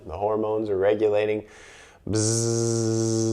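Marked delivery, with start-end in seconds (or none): 2.1-3.3 lips buzzing on a steady breath; a long bee-like buzz. Shown as a demonstration. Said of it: "I'm gonna buzz three times".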